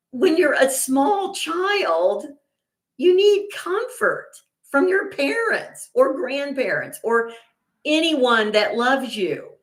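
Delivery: slow, high-pitched, emphatic plea; exasperated insistence. Real, not acted. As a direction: drag the words out high, arguing the obvious. Emphatic plea for comforting small children.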